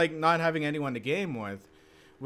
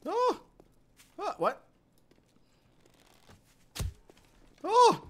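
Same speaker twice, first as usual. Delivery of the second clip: startled yelps; slower than usual. Real, not acted. Startled by a bear in game.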